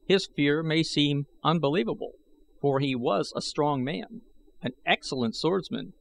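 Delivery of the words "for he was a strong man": ordinary delivery, nothing marked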